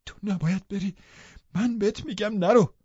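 high-pitched woman's desperate plea; clinging, high-voiced demand; desperate insistence. A narrator's reading. Catherine clings, forbids him leaving.

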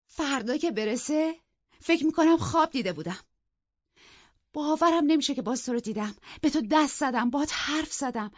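high, loud, carried away with joy; loud, overjoyed. A narrator's reading. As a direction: cry it out loud in giddy disbelief. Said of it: "بلند بلند گفت".

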